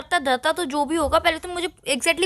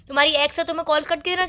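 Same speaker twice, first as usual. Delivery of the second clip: usual speaking voice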